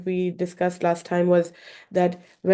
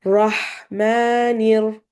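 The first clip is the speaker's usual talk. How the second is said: "Rahmanir" drawn out very slowly; slow recitation; 2 semitones higher, 4 dB louder, much slower than usual. Shown as a demonstration. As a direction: stretch "Rahmanir" out. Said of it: "we'll read one word at a time".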